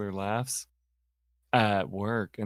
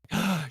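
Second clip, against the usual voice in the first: shocked gasp; 9 semitones higher than usual. Retold or imitated. Coworker shocked at unseen movie.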